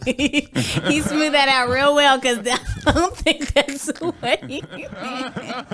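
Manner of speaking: high, loud words broken up by laughter; helpless real laughter through the sentence; laughing. Real, not acted. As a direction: crack up while talking. Co-host cracks up.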